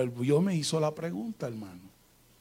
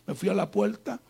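ordinary talk, nothing marked

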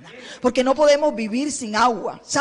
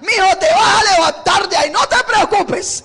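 high, loud joyful reassurance; joyful sisters; 5 semitones higher, 4 dB louder than usual. Retold or imitated. The sisters joyfully call to dying Lázaro, loud and high. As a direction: cry out with hope for Lázaro. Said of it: "mujeres gozosas, diciendo".

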